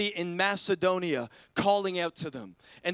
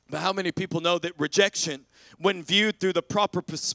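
normal talking voice

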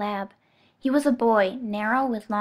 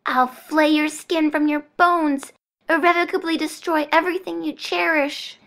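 slow, loud, hateful threat; menacing threat; 6 semitones higher, 5 dB louder than usual. Acted. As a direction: spit curses at her. Damsel threatening Bonesaw.

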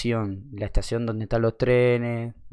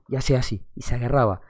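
usual speaking voice

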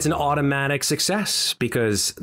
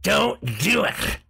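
commanding shout; 7 semitones higher than usual. Acted. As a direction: bellow the order to stop. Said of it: "And it says".

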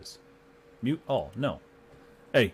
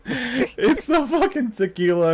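slow, laughing, higher; laughing excuse; 8 semitones higher, slower than usual. Real, not acted. Player blames tequila for being a dick, laughing slowly. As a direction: drag it out through a laugh. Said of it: "laughing excuse".

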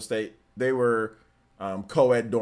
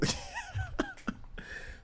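airy giggle; 11 dB quieter than usual. Real, not acted. Nervous laugh before the story.

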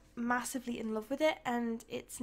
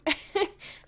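sheepish laugh; 9 semitones higher, 5 dB louder than usual. Real, not acted. Laughs at own cheating joke.

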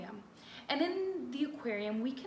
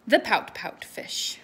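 title read; 9 dB louder, slower than usual. Acted. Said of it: "I wanted to read you Pout Pout Fish".

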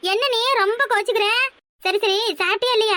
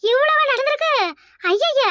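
shocked; 5 semitones higher, slower than usual. Acted. Hearing the police story, shocked and higher. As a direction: gasp it out in shock.

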